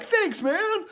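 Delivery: very high, loud imitated thanks; delighted thanks. Young man thanking a stranger for a compliment, delighted thanks. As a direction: voice the other man's pleased cry.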